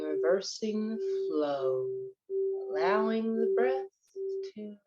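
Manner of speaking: unhurried, even-toned breath coaching; guided meditation. Acted. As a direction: guide listeners through breathing, drawing out key words.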